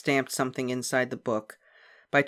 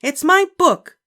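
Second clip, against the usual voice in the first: put-on high, loud stubborn child; stubbornly; 13 dB louder, slower than usual. A narrator's reading. Ramona answering her mother, stubborn and high. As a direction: dig in, refusing to budge. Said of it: "said Ramona stubbornly".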